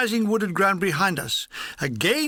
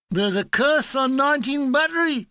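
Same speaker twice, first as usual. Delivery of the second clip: solemn; 6 semitones higher than usual. Acted. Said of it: "he says solemnly".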